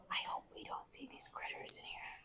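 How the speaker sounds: hushed cave whisper. Real, not acted. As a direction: whisper so nobody hears.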